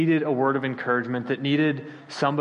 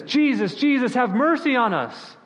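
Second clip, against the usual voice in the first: calling out higher and louder; yelling; 9 semitones higher, 3 dB louder than usual. Retold or imitated. Preacher voicing lepers crying out to Jesus, raised pleading yell. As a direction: cry out to Jesus from a distance, pleading. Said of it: "they start yelling at Jesus".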